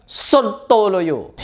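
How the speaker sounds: "Sontoloyo" stretched out very slowly in scorn; scornful verdict. Real, not acted. Commentator mocking the miracle claims, very slow scornful drawl. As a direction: drag out the insult with contempt.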